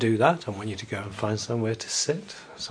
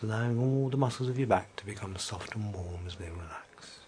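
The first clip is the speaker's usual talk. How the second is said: whispering. Acted. Relaxation induction, whispered.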